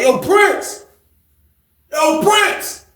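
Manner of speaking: high, long call across the room; hollering for a friend. Retold or imitated. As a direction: call his name down the hall. Calling him from across the pod.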